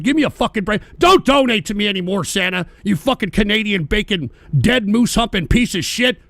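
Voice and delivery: ordinary talk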